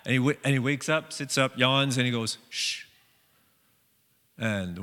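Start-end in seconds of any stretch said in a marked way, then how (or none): 2.5-2.8 a hushed, breathy shh; Jesus calmly shushing the wind; soft shush. Retold or imitated. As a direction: hush the storm gently. Jesus hushing the storm.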